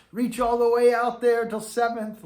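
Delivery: loud, high, overeager bark of an order; mock band director shout. Trombone teacher mocking band directors, a loud high yell. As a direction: ham up a pushy band director yelling instructions. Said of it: "band directors saying".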